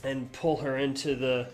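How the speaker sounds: loud, driven game master narration; dramatic narration. Acted. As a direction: tell the collapse like an epic climax.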